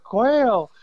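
put-on high, thrilled boyish voice; excited kid. Boy's first thrill at quail.

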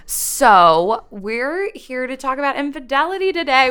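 loud, high hype announcement; hyped-up announcement. Acted. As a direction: sell it like a big reveal. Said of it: "You just gotta sell it".

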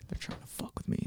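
whispered paranoid thought; inner thought. Retold or imitated. Dad recalling his suspicion of the riders, whispered. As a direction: breathe it as a secret suspicion.

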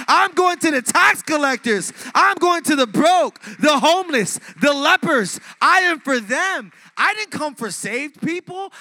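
loud, high, ringing sermon cry; full-force preaching. Acted. Pastor preaching to the congregation, full-force shouting. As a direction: preach it out at the top of your voice.

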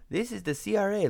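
a quick imitation of an Indian accent from a scam call. Imitating a scam caller from the CRA with a mock Indian accent. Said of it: "guy with an Indian accent".

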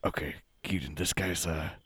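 whispered aside off mic; hushed aside. Acted. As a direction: say it low, to your partner. Aside to partner.

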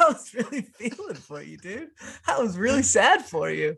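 hammed-up pity; mock sympathy.

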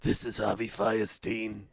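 hoarse, cracking sick voice; raspy changing voice. Real, not acted. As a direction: let the rough voice shift and crack. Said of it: "And the voice is changing".

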